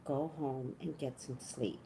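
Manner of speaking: soft, tired-sounding sister telling her to go home; soft sister's voice. Retold or imitated. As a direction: quietly repeat the sister's gentle order. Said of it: "She goes".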